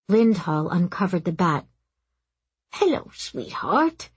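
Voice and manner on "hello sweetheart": slow, high, gentle greeting to a hurt bat; tender murmur. A narrator's reading. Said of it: "he murmured".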